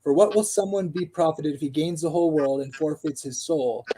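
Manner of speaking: usual speaking voice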